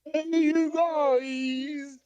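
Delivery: high, stretched call with volume held back; restrained, drawn-out monster cry; Sloth from Goonies. Shown as a demonstration. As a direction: do the long holler without really yelling. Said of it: "probably do a good Sloth impression".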